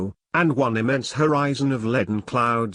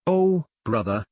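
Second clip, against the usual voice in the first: usual speaking voice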